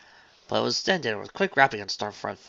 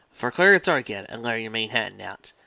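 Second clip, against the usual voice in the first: usual speaking voice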